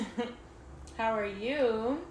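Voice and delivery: talking while chewing; words round a bite of food; mouth full. Real, not acted. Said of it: "Y'all gonna hear me chewing".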